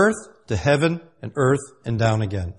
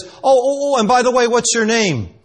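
high, loud needling afterthought; rubbing it in; 9 semitones higher, 5 dB louder than usual. Acted. God asking Jacob his name, rubbing it in. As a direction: toss in the question high and loud to rub it in. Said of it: "to rub it in, he says".

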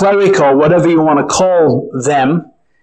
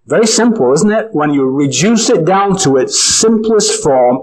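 ordinary talk

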